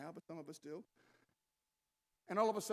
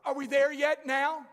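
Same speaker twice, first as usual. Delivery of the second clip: put-on higher, louder, slow whining child; whiny kid; 6 semitones higher, 12 dB louder than usual. Preacher imitating his kids whining from the back seat.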